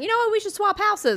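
high, sing-song scheming neighbor; mock sweet voice. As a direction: put on a fake friendly high voice. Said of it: "was like".

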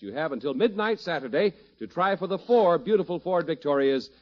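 booming vintage radio announcer; old radio announcer. Acted. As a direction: sell it like a fifties sponsor read. Sponsor announcer opening the show.

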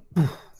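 mouth-made banging, repeated with pauses; loud percussive thump noises made by mouth; imitated loud bang. A narrator's reading. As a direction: voice the thud of something hitting wood. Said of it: "as if somebody's hitting something against wood".

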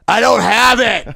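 high, loud, stretched indignant cry; mock shout. Maskless customer to narrator, indignant mock shout. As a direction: imitate a man yelling his denial. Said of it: "Like that".

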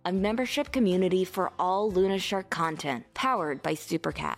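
soft, upbeat podcast ad read. Acted. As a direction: read it like a friendly sponsor spot. Sponsor ad read.

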